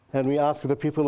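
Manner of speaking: normal talking voice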